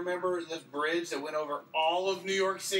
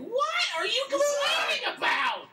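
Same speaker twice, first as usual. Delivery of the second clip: loud, high, slowed protest; shout; 4 dB louder, slower than usual. Real, not acted. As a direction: yell it in disbelief. Playful argument, shouting.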